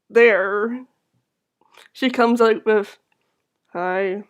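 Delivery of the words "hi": no emotion at all; monotone. Acted. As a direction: say it dead and blank. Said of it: "very monotone and not displaying any emotions".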